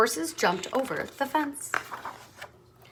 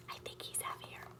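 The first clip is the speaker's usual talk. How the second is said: whisper; 25 dB quieter than usual. Acted. Aside to the listening children.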